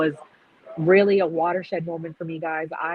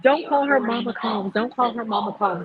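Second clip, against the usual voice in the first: woman calling out; 5 semitones higher than usual. Retold or imitated. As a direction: call out like the woman outside.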